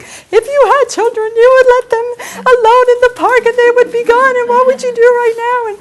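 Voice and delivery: high, loud, accusing inner critic; mock scolding voice. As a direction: scold yourself like a harsh parent. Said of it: "but I'm like".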